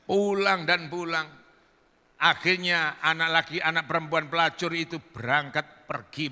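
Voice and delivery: ordinary talk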